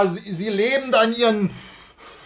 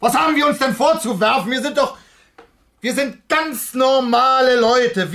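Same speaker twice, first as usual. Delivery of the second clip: indignant outburst; 6 semitones higher than usual. Acted. Husband bristling at the old man, indignant and fast. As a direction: flare up in protest, words tumbling out.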